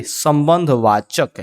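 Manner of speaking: drawn out slowly for students; slow classroom drill; slow drilled answer. Shown as a demonstration.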